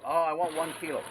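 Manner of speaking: loud, high mock order; mock expat demand. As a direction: play the greedy buyer. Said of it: "He goes".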